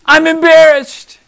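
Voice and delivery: high, loud, slow hammed-up whine; mock whining Jonah; sulking Jonah. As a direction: overplay a sulking prophet's wail. Jonah voiced after whisper.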